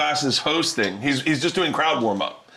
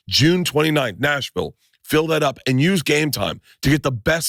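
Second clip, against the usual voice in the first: upbeat host-read sponsor spot; 3 semitones lower than usual. Acted. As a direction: sell the app with a friendly push. Reads a sponsor ad.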